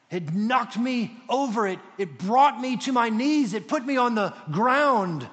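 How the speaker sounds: loud, high, forceful retelling; overwhelmed outcry. Retold or imitated. Preacher relives Paul struck down by the light, overwhelmed outcry. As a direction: cry it out as if knocked flat.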